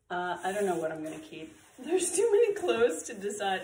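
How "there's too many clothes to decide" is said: laughing through the words, louder and higher. Real, not acted. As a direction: say it while laughing at yourself. Overwhelmed by the haul, laughing.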